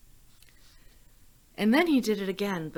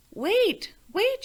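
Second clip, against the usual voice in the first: high, loud call to stop him; shouted; 13 semitones higher, 8 dB louder than usual. Acted. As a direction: shout to catch the dragon before the door shuts. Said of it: "shouted Elizabeth".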